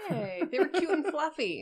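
indignant squeak in their defense; mock protest. Real, not acted. Player defending the fluffy creatures, indignant.

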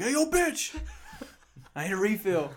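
high, loud call across the restaurant; mock yell for a refill. Friend retelling how he yelled at the waitress, loud mock holler. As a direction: holler at the waitress, half joking. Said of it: "So I was like".

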